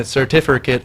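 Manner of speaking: "certificate" squashed into a quick "sir-TIF-a-kit"; imitated quirky "certificate", middle swallowed; Miss Davis's pronunciation. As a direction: say "certificate" as a colleague mispronounces it, ending in "kit". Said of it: "as Miss Davis says".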